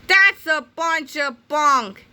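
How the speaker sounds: put-on slow, loud scornful kid voice; a cranky kid scoffing at the boys; scoffing yell. A narrator's reading. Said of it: "yelled Melvin".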